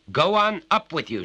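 loud, high barked order; shouting. A narrator's reading. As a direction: shout him up the stairs. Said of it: "shouted his father".

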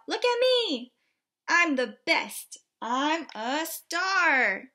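loud show-off shout, drawing out the last words; boastful shout. Acted. Pig the pug showing off all day, shouting. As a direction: shout it out slowly and proudly for everyone. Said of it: "He'd shout".

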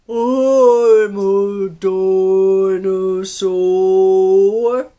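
loud, slow, drawn-out boom; booming dinosaur. Acted. Reader voicing huge stomping dinosaur answering Pipkin. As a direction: boom like a giant stomping beast. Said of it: "the creature boomed".

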